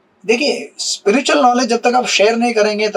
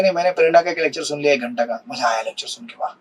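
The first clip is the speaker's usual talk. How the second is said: lightly mimicked satisfied listener, low and trailing softer; imagined listener's excuse; 5 semitones lower, 6 dB quieter, faster than usual. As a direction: drop into a low, offhand imitation. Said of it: "आप बोलेंगे".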